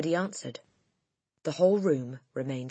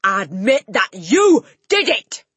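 loud, slow, higher furious demand; roaring demand; 7 semitones higher, 13 dB louder, slower than usual. Acted. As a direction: force a confession out of her. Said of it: "roared the Trunchbull".

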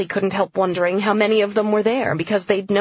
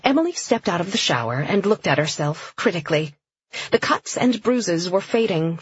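ordinary delivery

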